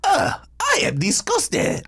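high, hammed-up French disgust; French accent. Reacting to the fries in a mock-French accent. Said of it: "we were doing the French accent".

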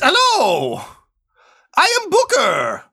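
high, slow put-on bookie voice; a squeaky-high NPC clerk, slowing down; booking agent voice. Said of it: "and says".

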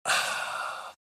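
long, soft, breathy sigh; mock server's quiet sigh; imitated exasperated sigh. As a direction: let out a weary, put-upon sigh. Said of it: "They're like".

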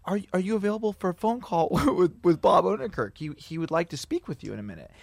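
a hint of a polite go-between; assistant on the phone. Assistant asks the caller to take a phone call, lightly voiced.

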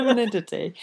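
"Anonymity" said through a laugh, relieved; "Anonymity" finally whole, laughing at the struggle; laughing success. Real, not acted. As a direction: get "Anonymity" out while laughing. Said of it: "that's the one".

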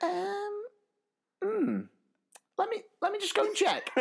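very high, hesitant woman's voice; high put-on woman unsure of stock; hesitant shop worker. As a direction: hum and haw up high like a shop assistant checking.

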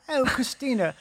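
tipsy aristocrat's British greeting; upper-crust British. Acted. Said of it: "like, the upper crust".

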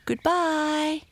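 put-on very high child's farewell; children answering. A narrator's reading. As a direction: call back like waving children. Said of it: "answered George and Mabel".

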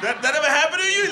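normal talking voice

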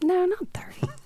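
hushed aside; whisper. Real, not acted. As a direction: whisper it so nobody hears. Whispered aside about age.

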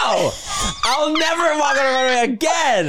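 high hammy wail; dramatic shout. Acted. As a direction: cry it like a diva. Dramatic refusal.

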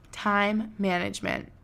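time management said slowly, with space; slow it down on purpose. Shown as a demonstration. Host slowing down after rushing, slow deliberate delivery. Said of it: "we're slowing down".

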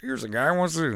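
excited coach order; Gruden demanding. Retold or imitated. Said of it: "yells at him to do impressions".

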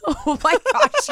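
high, slow squeal through laughter; laughing oh my gosh. Real, not acted. Laughing at her friend's answer, a high squealing laugh. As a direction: squeal it while cracking up.

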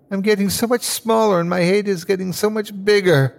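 high-pitched, swelling resentment; mounting bitter anger. Acted. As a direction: let the spite build as it takes you over. Dying man swelling with hate.

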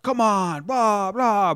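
high, drawn-out imitation of dugout barking; mock screaming. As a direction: mimic players hollering out to the field. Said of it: "screaming out to the field".

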